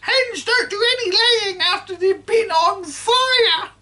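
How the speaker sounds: put-on high, loud screeching old woman; cranky grandma yelling from the roof; shouting grandma. A narrator's reading. Grandma voiced throughout.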